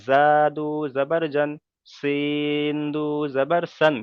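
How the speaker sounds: slow, level chant from "তা দু জাবার তান" onward; tanween spelling drill. Shown as a demonstration. Teacher modelling letters for students, slow tanween drill. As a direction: draw out each letter name and দু, ending crisply on তান, সান, জান. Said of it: "এরকম ভাবে আমরা".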